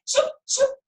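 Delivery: mock the grown-ups' shoo. Dhani mimicking the elders who shoo him. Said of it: "they say".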